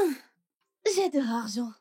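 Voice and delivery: usual speaking voice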